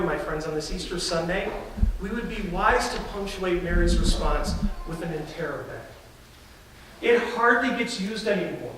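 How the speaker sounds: normal talking voice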